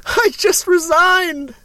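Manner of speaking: high, drawn-out outburst; mock indignant resignation. Host playing himself quitting his own job, mock indignant. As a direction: squeal it slowly in mock outrage. Acting the letter's indignant reply.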